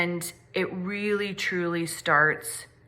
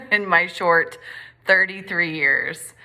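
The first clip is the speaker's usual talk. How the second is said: said through a real chuckle, louder; laughing; 6 dB louder than usual. Real, not acted. Speaker joking to viewers about their young age, laughing. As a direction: let the laugh spill into the words.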